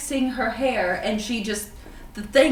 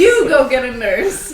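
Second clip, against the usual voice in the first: laughing; 2 semitones higher, 10 dB louder than usual. Real, not acted. Laughing bickering over who goes.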